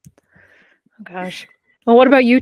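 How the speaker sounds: ordinary talk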